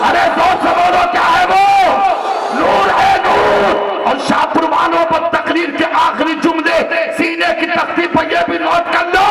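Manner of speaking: full-force preaching shout; shouted climax preaching. Acted. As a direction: hammer the point home to the crowd.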